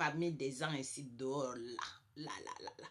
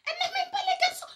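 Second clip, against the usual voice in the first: much higher, fast, through laughter; laughing; 6 dB louder, much faster than usual. Real, not acted. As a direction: laugh it out in disbelief. Se moque en riant. (en éclatant de rire)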